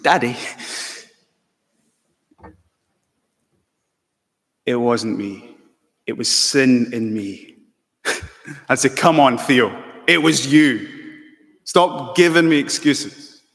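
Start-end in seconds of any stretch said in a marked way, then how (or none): 0.0-7.6 put-on English accent. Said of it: "He started speaking with an English accent". 8.9-13.4 put-on louder, firm telling-off of a child; stern fatherly scolding. Father scolding little Theo, firm and louder.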